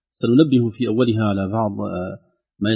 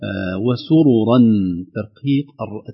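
ordinary delivery

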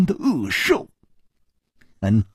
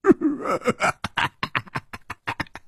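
high breathy silly giggle; a dim-witted brute tittering to himself; 傻乎乎的痴笑; foolish giggle; 14 semitones higher, 4 dB quieter than usual. Acted. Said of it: "那大汉竟然痴痴地笑起来".

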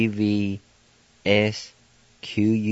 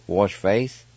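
usual speaking voice

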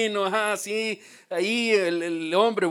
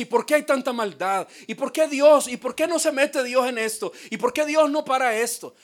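higher pleading questions; imitating people questioning God, higher; people's complaint; 6 semitones higher, 3 dB louder than usual. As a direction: ask like a puzzled doubter. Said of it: "La gente dice".

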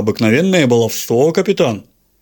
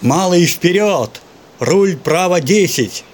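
slow, high, drawn-out yelled orders; echoing shout; 6 semitones higher, slower than usual. Acted. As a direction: yell the orders back slowly. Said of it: "проорало эхо голосом мотыля".

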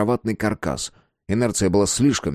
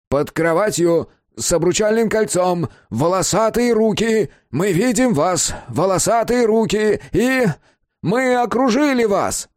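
strained loud announcement; 13 semitones higher, 4 dB louder than usual. A narrator's reading. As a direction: hail someone hiding, overdoing the volume. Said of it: "чрезмерно громко объявил Безил".

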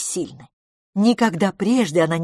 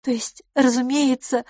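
higher character voice; self-correcting lady; 7 semitones higher than usual. Acted. Lady corrects herself about her mother, higher voiced. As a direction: lift the pitch for the lady.

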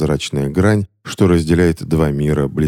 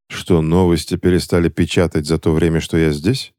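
ordinary delivery

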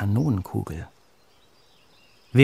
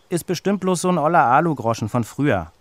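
higher, louder, scornful brush-off; dismissive; 6 semitones higher, 5 dB louder than usual. A narrator's reading. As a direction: belittle the find, a touch of contempt. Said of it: "meint Benny geringschätzig". (leicht geringschätzig, höher und lauter)

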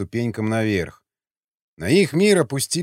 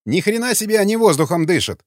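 a higher, louder call of disbelief; shouting guard; 7 semitones higher, 3 dB louder than usual. A narrator's reading. The guard yells at the two fighters in surprise. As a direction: yell out at the brawling men. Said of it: "закричал выбравшийся на шум постовой".

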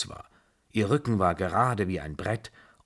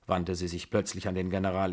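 plain reading voice

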